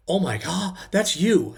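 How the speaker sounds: radio host realizing the caller is the guy; sudden realization. Retold or imitated. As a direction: blurt it out, caught off guard. Radio host realizes it's him.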